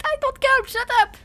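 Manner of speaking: high, loud playful snap; indignant protest. Real, not acted. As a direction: fire back at the teasing chat. Chat teases her.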